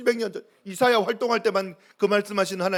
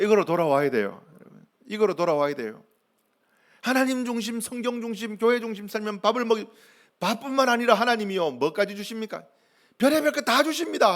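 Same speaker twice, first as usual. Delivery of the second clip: high, driving sermon voice; fervent preaching; 5 semitones higher, 4 dB louder than usual. Real, not acted. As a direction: build to an emphatic high promise.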